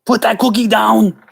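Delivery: high, forceful Austrian action-hero yell; Arnold Schwarzenegger. Acted. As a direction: belt out the order as an Austrian action star. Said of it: "doing Arnold voice".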